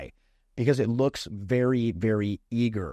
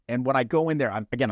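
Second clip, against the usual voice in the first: ordinary talk, nothing marked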